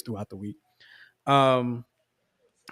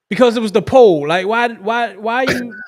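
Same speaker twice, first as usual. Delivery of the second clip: worked-up rant; 8 semitones higher, 9 dB louder than usual. Real, not acted. Worked up over the video.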